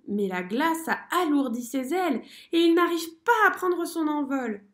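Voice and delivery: plain reading voice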